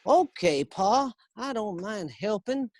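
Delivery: put-on high, slow frontier child; prairie boy. Shown as a demonstration. Billy answering Pa on the prairie, high drawn-out voice. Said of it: "and I go like".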